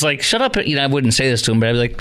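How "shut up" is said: high-pitched imagined heckle; acted outburst, voice jumping high; heckling boo. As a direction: cut in like an indignant diner. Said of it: "I probably would have booed it".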